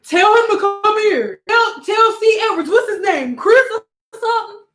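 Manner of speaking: high, loud call for the worker; retold customer yelling to fetch C. Edwards. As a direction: shout for the guy at the counter. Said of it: "somebody was like".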